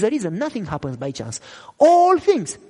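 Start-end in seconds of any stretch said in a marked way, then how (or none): 1.8-2.6 loud, high proclamation; emphatic preaching. Acted. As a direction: proclaim it to the church.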